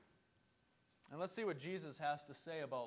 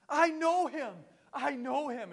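high, loud, bursting with glee; thrilled Buddy the Elf; 9 semitones higher, 10 dB louder, slower than usual. Retold or imitated. Buddy the Elf boasting he knows Santa, giddy. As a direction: blurt it out, giddy with joy. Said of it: "Buddy gets really excited".

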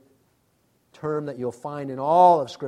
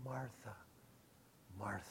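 gentle loving call, much softer and lower; very kindly; 8 semitones lower, 26 dB quieter than usual. Acted. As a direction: say her name tenderly. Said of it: "very kindly says".

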